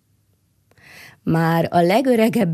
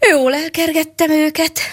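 forest creature; 8 semitones higher than usual. A narrator's reading. Forest creature pleased with itself.

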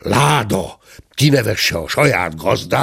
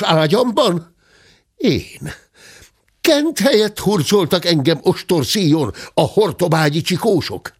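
higher, accusing questions one after another; lightly voiced interrogating gentleman; 7 semitones higher than usual. A narrator's reading. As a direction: grill the culprit, growing indignant. Angry gentleman interrogating the stowaway. (számonkérő úr hangja)

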